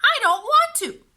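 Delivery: put-on high, loud whiny child voice; a stubborn little sister refusing; whiny little girl. A narrator's reading. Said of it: "Beatrice repeated".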